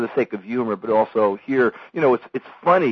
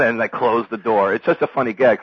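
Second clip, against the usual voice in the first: ordinary talk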